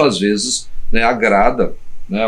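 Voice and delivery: ordinary talk, nothing marked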